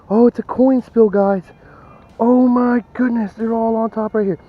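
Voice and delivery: high, loud and thrilled at the coin spill; gasping with delight as the coins pour out; real excitement. Real, not acted. Metal detectorist shows viewers a coin spill, thrilled and loud.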